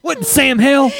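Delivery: put-on high old-timer voice with a laugh; hammed-up old prospector. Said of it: "they're always like".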